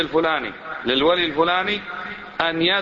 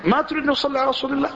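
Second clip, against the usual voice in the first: outraged question, pitched much higher; indignant retort; 10 semitones higher, faster than usual. Retold or imitated. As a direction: play the offended objector, voice jumping high. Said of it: "قال".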